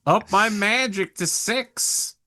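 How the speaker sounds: whiny begging. Pretend. Player begging the GM for karma.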